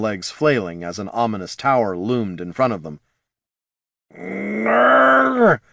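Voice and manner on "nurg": loud, high drawn-out growl; strained growl. Acted. Jin refusing while straining, loud growl. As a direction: force it out in refusal. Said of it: "growled Jin".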